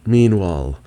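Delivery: ordinary delivery, nothing marked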